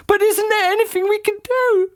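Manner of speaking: put-on squeaky puzzled old lady; Missus Twit voice. A narrator's reading. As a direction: play her as a high, befuddled old wife.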